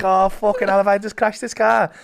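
high, loud mock alarm; retold panic. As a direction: blurt it out as if you have just crashed. Acts out panic after the crash.

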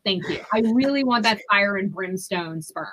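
sarcastic, emphatic customer placing an order; mock-earnest sarcasm. Comic mock request to a fertility clinic, loudly sarcastic. Mocks clinic matching on religion.

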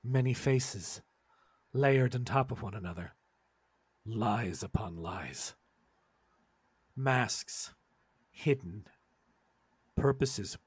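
slow, low, hushed and ominous; ominous narration. A narrator's reading. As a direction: intone it like a dark prophecy. GM eerie scene narration.

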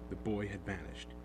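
usual speaking voice

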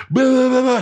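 high, babbled imitation of a screaming rant; mock screaming. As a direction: mimic her shrieked tirade as gibberish. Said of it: "starts screaming".

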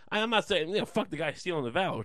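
ordinary talk

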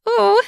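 sudden high-pitched squeal of surprise. A narrator's reading. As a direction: let out a startled little squeal. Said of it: "I squeal".

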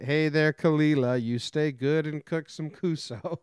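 lightly sung in a mock Indian accent; fake Indian accent. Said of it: "a guy doing a fake Indian accent".

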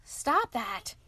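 a hint of a snarling wolf; growling wolf. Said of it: "growled the wolf".

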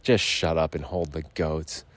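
low, curt order to shut up; mock gruff command; gruff boss. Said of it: "And he's like".

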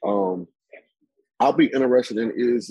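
normal talking voice

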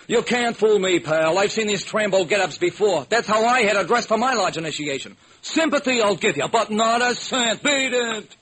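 high gruff tough-guy voice; 1930s beat cop. Acted. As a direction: brush off the bum, streetwise. Tough guy refuses bum.

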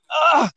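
high yelp of disgust; a sharp high ugh after a bad sip; disgusted gasp. Son retelling tasting tomato juice, high yelp. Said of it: "I took a sip and I was like".

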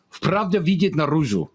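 "Pravda vidit naruzhu" spoken in Russian pronunciation; Russian phrase. Retold or imitated. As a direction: quote the friend's Russian saying. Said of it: "What do I say?".

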